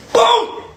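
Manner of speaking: high loud "Boom!" with a laugh. Acted. Showing the punch again with a laughing boom. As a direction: punch the word out, cracking up.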